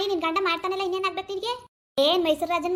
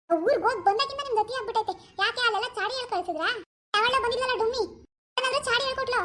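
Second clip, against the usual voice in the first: daughter-in-law role; 3 semitones higher than usual. Acted. Actor voices daughter-in-law scolding husband about mother. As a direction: complain sharply to husband about his mother.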